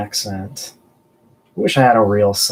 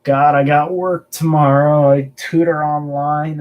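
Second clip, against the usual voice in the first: ordinary talk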